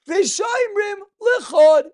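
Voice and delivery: V'shomrim lechod called out high and loud, slowly; emphatic teaching shout. Shown as a demonstration. Teacher driving home that each half stands alone, loud and slow. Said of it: "Each one lechod by itself".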